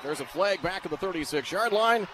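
ordinary delivery

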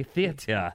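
"theater" as "THEE-uh-tuh"; insistent odd pronunciation. Shown as a demonstration. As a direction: say "theater" with no final r, ending in "tuh". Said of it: "Uh, you heard me".

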